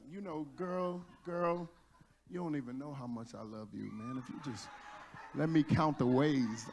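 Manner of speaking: hammed-up low romantic wooing; Mac Daddy smooth talk. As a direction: lay on your best phone game, pitched low. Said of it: "I'm putting down my best".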